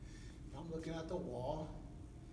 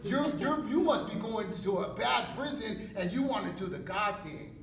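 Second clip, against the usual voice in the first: very loud, high-pitched ribbing; mock jeering; 7 semitones higher, 11 dB louder than usual. Homie ribbing inmate about the God thing, loud jeering. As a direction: tease him at full volume, pitched up.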